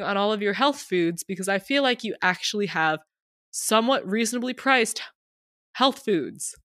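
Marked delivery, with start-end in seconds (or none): none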